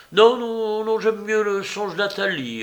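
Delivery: loud, high protest; emphatic refusal. A narrator's reading. Bengali refuses to hear the lover's dream, emphatic protest. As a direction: cut the storyteller off, pitched well up.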